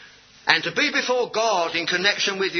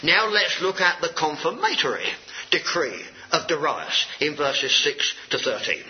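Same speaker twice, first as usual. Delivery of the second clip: ordinary delivery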